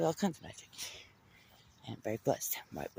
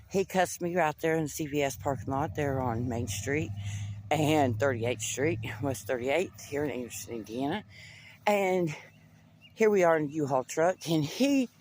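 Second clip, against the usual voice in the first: usual speaking voice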